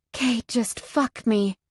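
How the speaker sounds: slow, gasping, out-of-breath plea; breathless urgent whisper-plea; gasped plea. Acted. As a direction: beg between gasps, slowly. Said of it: "I gasp".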